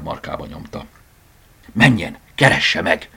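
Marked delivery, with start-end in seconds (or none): 1.7-3.1 slow, loud, firm order; firm instruction, each word weighted; decisive. A narrator's reading. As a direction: send him off with determination. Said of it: "szólt határozottan".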